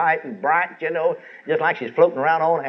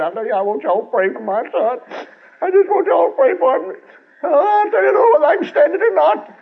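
much higher, louder whining voice of a self-pitying father; mock self-pity; 12 semitones higher, 4 dB louder than usual. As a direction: parody a man who cries for himself, rising higher as he goes. Mocking self-pitying preacher father.

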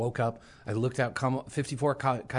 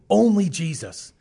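high, loud and slow "Only Jesus"; forceful declaration; 7 semitones higher, 9 dB louder, slower than usual. Retold or imitated. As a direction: proclaim it firmly.

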